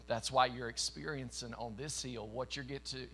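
ordinary delivery

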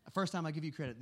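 ordinary talk, nothing marked

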